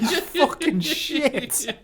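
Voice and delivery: high, slow, laughing insult. Real, not acted. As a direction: drag it out through laughter.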